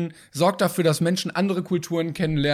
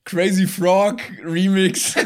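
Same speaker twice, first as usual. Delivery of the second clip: mock announcement; 2 semitones higher, 4 dB louder, much slower than usual.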